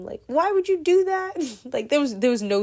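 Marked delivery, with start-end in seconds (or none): 0.3-1.3 very high, loud exasperated shriek; retold yell. To her brother after he threw up, a loud shriek. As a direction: scream it at him in disgust. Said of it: "I remember yelling at him".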